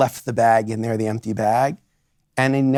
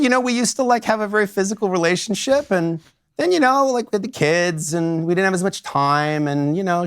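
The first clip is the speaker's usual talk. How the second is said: higher, lightly voiced wife remembering; lightly voiced client; 7 semitones higher than usual. Lawyer retells a client describing her marriage, higher voice. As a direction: speak as the wife, a little higher. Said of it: "She said".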